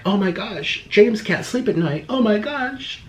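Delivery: high, airy fake gasp of concern; a swooning mock alarm, voice up high. Mocking fans to his audience, high swooning fake gasp. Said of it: "they're like".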